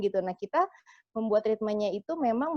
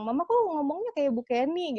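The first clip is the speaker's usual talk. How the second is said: put-on children's remark; 6 semitones higher than usual. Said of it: "itu kayak sampai selalu bilang".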